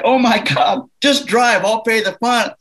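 imitating a frantic father begging the driver on; panicked dad. Dad pressing the driver to keep going, mock panic. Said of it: "my dad's like".

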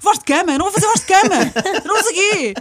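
high, loud mock-furious refusal; revolted outburst. [name] refusing to do a bedroom voice, furious. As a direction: explode in hammed-up indignation. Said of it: "[name] revoltada".

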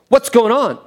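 voicing the rude guy at the counter; impatient customer. Man at counter, mock impatient. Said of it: "and he goes".

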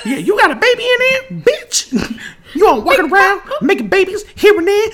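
hammed-up old man bragging. Riffing on the cartoon baby for friends, high loud old man voice.

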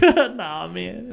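mock chuckled denial; imitated dismissive laugh. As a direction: brush it off with a chuckle. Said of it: "he just laughed at me".

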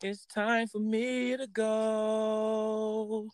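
high, very slow, drawn-out farewell; a showpiece voice for the listeners; signature voice. Shown as a demonstration. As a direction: stretch the goodbye out in a sing-song high voice. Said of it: "let our listeners hear your voice one more time".

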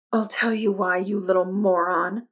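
understated, contemptuous hiss; mildly venomous comeback; hissed retort. A narrator's reading. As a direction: hiss the insult with restrained venom. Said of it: "Fawn hissed".

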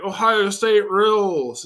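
loud, high, drawn-out mocking yell; mock fan cheer. Host mocking Ohio State fans, hammed-up drawn-out cheer. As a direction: imitate an obnoxious football fan bellowing.

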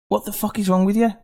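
high-pitched indignant rant; mock telling-off, pitched right up; exasperated. Exasperated rant addressed to taxi drivers.

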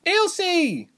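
high-pitched call from afar; distant cry. A narrator's reading. Reshma calling to Ilse from a distance, high cry. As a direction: call out to a friend far away. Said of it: "Reshma cried from a distance".

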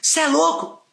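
high, loud, fast outraged yelling; angry stranger yelling at a man over his dog. Retold or imitated. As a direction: berate the dog owner in outrage. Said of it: "começou a berrar comigo".

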